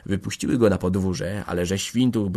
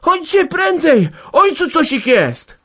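high, drawn-out cry for help; loud, slow, very high shout; alarmed shouting; 10 dB louder, slower than usual. A narrator's reading. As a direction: burst in screaming the bad news. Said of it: "wpadła z krzykiem Magda".

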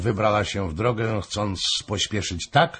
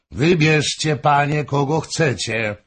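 higher, louder, impatient call; bored king calls out; 6 semitones higher, 3 dB louder than usual. A narrator's reading. King ending the fuss, a higher impatient call. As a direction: toss it out, tired of the scene. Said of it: "zawołał król, trochę tą sceną znudzony".

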